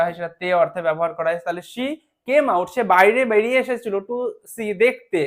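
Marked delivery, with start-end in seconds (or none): none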